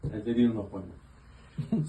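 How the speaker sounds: usual speaking voice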